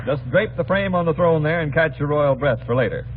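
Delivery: ordinary delivery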